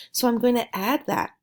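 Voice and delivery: ordinary delivery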